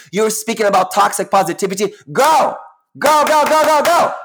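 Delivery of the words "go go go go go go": higher and louder, go go go; excited cheering. Real, not acted. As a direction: egg the viewers on, fired up. Cheering the audience on.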